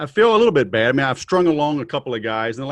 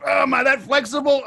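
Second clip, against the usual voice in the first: surprised; 11 semitones higher than usual. Real, not acted. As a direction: caught off guard, squeal it. Surprised shout, high.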